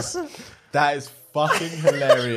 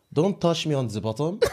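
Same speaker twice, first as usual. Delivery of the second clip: softly put-on comic French accent; 2 semitones higher, 5 dB quieter than usual. Shown as a demonstration. Having a proper go at the friend's phrase, French accent.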